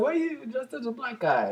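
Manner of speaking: high silly voice. Retold or imitated. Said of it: "And he's like".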